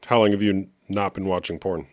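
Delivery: ordinary delivery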